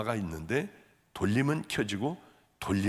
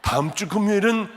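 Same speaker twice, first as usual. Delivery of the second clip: lightly voiced sympathetic churchgoer, higher and louder; playful pretend congregation; 6 semitones higher, 5 dB louder than usual. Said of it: "이런 생각하지 마시고".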